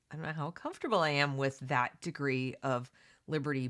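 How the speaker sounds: ordinary delivery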